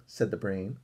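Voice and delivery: ordinary delivery, nothing marked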